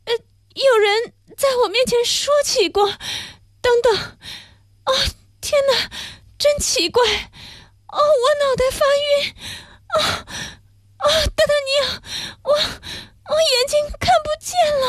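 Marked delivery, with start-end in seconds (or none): 3.6-14.8 high, tearful, fading gasps of a poisoned woman; weeping dying woman. Acted. Poisoned Madame Bonacieux weeps to d'Artagnan as her sight fails, high and tearful. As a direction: sob out each word as strength fails.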